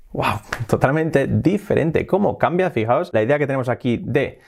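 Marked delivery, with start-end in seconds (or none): none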